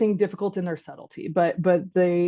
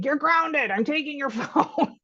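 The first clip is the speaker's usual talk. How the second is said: high, slow mock-stern parent, laughing at the end; hammed-up punishment, breaking into a laugh; angry parent; 8 semitones higher, slower than usual. Parent grounding a teen who slammed the door, hammed-up stern.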